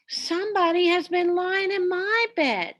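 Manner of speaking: a lightly voiced mama bear, miffed about her chair; middle-sized bear voice. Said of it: "in her middle-sized voice".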